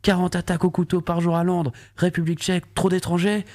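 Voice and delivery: mock headline voice. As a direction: read it like a sensational headline. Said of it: "ça donnait ça".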